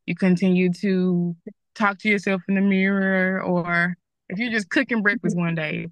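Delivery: ordinary talk, nothing marked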